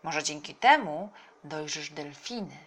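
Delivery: ordinary delivery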